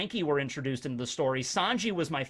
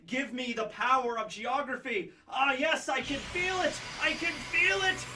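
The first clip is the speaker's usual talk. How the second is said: higher and higher, summoning power; rising ecstatic; 7 semitones higher, 4 dB quieter than usual. Pretend. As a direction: build up like drawing in energy.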